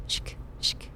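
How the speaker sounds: soft voiceless shk shk like a blade scraped on stone; imitated blade sharpening. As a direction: whisper the scrape of metal on stone to spook the listener. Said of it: "Metal whispering on stone".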